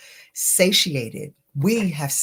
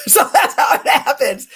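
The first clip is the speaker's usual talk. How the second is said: laughing through it; 10 semitones higher, 5 dB louder than usual. Real, not acted. Laughing at the story.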